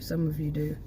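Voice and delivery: ordinary talk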